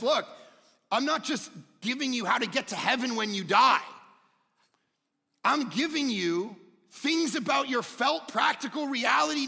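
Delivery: high and loud, pressing the point; fervent preaching. Acted. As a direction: proclaim it to the congregation. Voices the Bible's message loudly.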